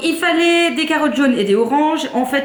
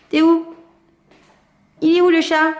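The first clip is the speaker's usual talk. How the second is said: talking to a pet; 6 semitones higher than usual. Real, not acted. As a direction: call out for the hidden cat. Calls for the hiding cat.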